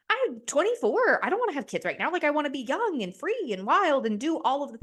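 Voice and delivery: high, defiant self-quote; restless protest. Retold or imitated. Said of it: "'Cause I'm also like".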